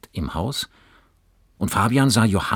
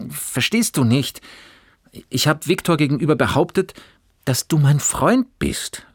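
higher and louder, pleading outburst; crying out; 10 semitones higher, 5 dB louder than usual. A narrator's reading. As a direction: burst out, begging him to understand. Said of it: "rief Johannes".

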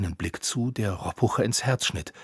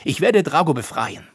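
higher, louder vow; boy vowing to free the dragon, louder and higher; determined cry; 6 semitones higher, 6 dB louder than usual. A narrator's reading. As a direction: declare it firmly, loud. Determined vow after protest.

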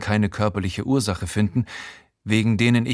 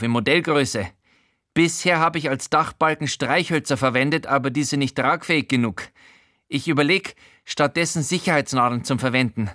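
high odd man's voice, eager; a higher, earnest hobbyist rambling; Showalter's voice; 5 semitones higher than usual. Acted. Showalter explaining his model railway roof, higher eager voice.

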